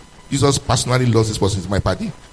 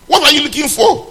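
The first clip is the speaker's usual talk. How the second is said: high, loud rhetorical question; challenging shout; 11 semitones higher, 6 dB louder than usual. Real, not acted. Preacher challenging his congregation, high loud question. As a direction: fling the question at them.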